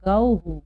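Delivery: गौहु said firmly with the visarga as हु; गौहु as gau-hu; model reading. Shown as a demonstration. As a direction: repeat गौहु with a clear हु. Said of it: "क्या पढ़ेंगे?".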